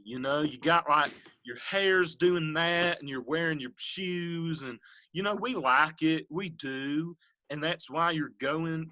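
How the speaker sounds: [name] impression. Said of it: "Lionel was gonna be".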